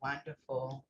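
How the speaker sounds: host softly calmed after the breathing talk. Real, not acted. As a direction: let it come out soft and calm. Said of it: "You even got me to calm down".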